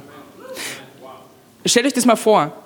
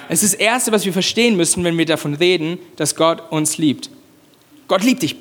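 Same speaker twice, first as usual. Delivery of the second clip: ordinary talk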